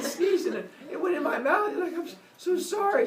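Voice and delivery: high, stammering mock panic; flustered excuse. Kronos excusing himself to his wife, high flustered stammer.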